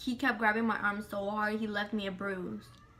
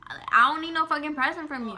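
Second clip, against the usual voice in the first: louder, higher final refusal; heated mock yell; 5 semitones higher, 7 dB louder, faster than usual. Final refusal to the harasser, a heated louder yell. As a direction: slam the door on it, loud. Retelling angry reply to harasser.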